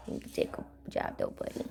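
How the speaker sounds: whispering. Real, not acted. Whispering to dog.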